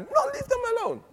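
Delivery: emphatic plea. Real, not acted. Preacher pleading with the church, pitched high. Urges hearers to leave converts alone.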